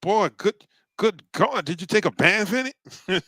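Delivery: high-pitched mock scolding; incredulous elder. Elder scolds boy drenched in aftershave, high mock disbelief. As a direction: exclaim it high, as an appalled elder. Acted elder reacting to cologne.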